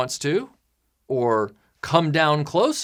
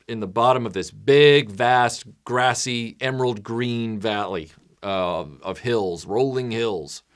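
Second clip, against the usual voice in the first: ordinary talk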